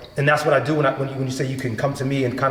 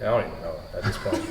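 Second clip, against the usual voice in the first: sheepish aside; 9 semitones lower, 8 dB quieter, faster than usual. Retold or imitated. He answers a question on set.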